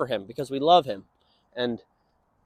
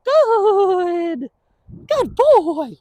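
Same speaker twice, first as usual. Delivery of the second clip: high, bright praise to the dog; 11 semitones higher, 4 dB louder, much slower than usual. Real, not acted. Trainer praising his dog, high-pitched. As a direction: cheer the dog on, pitched well up.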